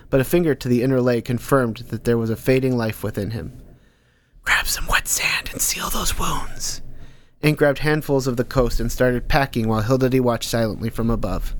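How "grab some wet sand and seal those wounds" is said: breathy unvoiced whisper, close and secret. Unseen Nordstrom whispering advice to Ank. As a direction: murmur it like a hidden advisor in his head.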